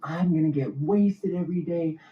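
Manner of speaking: high, wild tipsy boast; giddy party boast. Retold or imitated. Tipsy wife boasting to her friends, high and giddy. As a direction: boast it like a drunk bachelorette. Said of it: "she's like".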